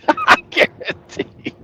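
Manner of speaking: breaking into helpless high laughter; laughing. Real, not acted. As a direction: squeeze the words out through laughter. Cracks up at own point.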